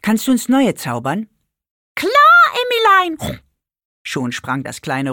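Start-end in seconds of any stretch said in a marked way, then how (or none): 0.0-1.3 put-on a bit higher, eager child asking; lightly voiced little girl Emmy. A narrator's reading. Emmy asks the pig for new cookies, lightly raised. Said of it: "fragte Emmy". 1.9-3.4 very high, loud squeaky pig voice; pig creature voice. A narrator's reading. Pink pig cheerfully answers Emmy, very high and bright.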